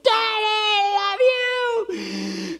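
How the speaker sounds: put-on squealing, very high, long-drawn child's cry of love; excited kids. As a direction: mimic a clinging kid, stretching it out. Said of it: "'cause they're just like".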